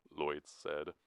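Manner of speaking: plain reading voice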